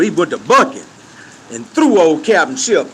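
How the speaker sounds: ordinary talk, nothing marked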